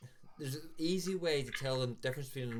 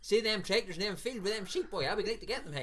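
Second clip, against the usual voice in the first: put-on higher, louder broad Ballymena farmer accent; rural Ballymena farmer; 8 semitones higher, 4 dB louder than usual. Mocking the farmers two miles out of Ballymena, broad rural accent. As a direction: lay the country farmer brogue on thick. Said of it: "a wee bit farmer in the gap".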